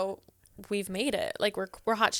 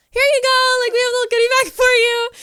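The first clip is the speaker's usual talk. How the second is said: higher, louder, fast overeager hostess chirp; chirpy hostess voice; 14 semitones higher, 9 dB louder than usual. Retold or imitated. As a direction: push the bag at them with bright fake cheer. Handing goodie bags to fan.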